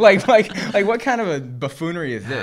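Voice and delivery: high, loud, laughing through the words; laughing disbelief. Real, not acted. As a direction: crack up while asking. Laughing at absurd burglar plan.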